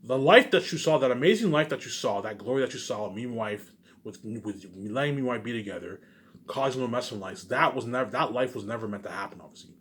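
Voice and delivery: ordinary talk, nothing marked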